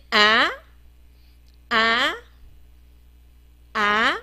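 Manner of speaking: 啊 sweeping upward at the end; 字母发音示范. Shown as a demonstration. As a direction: say 啊 as "ah" with a sharply rising tone. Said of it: "我们念啊". (念“啊”，尾音明显上扬)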